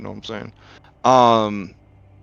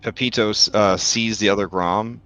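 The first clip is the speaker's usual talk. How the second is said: ordinary delivery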